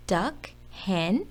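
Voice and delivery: ordinary delivery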